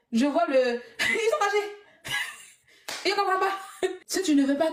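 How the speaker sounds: laughing through the words; amused laughing taunt. Real, not acted. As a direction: giggle while mocking them. Laughing at angry critics.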